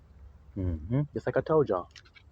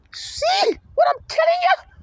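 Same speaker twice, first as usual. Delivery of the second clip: preaching shout; slower than usual. Real, not acted. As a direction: drive it home to the church.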